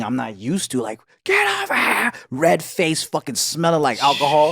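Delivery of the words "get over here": high, strained dad scream; imitated yelling. Friend's dad ordering the running kids over, mock screaming. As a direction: screech it like the enraged dad. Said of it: "But this whole yelling".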